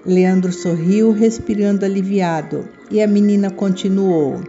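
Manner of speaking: ordinary delivery, nothing marked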